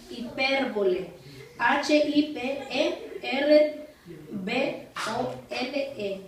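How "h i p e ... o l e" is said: letter by letter, with gaps; slow spelling out. Shown as a demonstration. Teacher spelling a term for students to write, slow dictation. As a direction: dictate each letter slowly.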